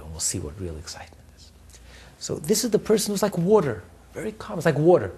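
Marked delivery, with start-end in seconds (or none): 0.0-1.5 quick, low conspiratorial whisper; whispered aside. Acted. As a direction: drop to a knowing whisper. Sly aside after warning.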